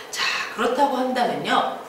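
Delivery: ordinary delivery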